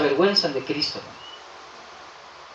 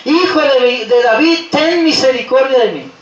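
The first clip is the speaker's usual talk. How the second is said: raised, higher pleading cry; insistent shouting; 3 semitones higher, 4 dB louder than usual. Acted. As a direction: keep crying out for mercy. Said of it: "Y gritaba más y más". (grito insistente de súplica)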